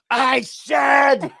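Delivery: high, loud frustrated shout; mock shout. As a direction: yell it at the machine. Shouting at phone bot.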